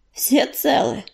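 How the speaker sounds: slightly hushed, mildly hoarse check; a touch of a hoarse voice. A narrator's reading. Injured leader Огнезвёзд checks on his cats, mildly hoarse. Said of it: "раздался хриплый голос Огнезвёзда".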